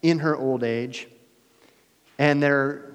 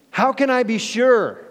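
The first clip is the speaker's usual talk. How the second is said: high, louder doubtful question; doubting Zechariah; 11 semitones higher, 6 dB louder than usual. Acted. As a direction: ask it high, full of doubt. Zechariah's doubt quoted again.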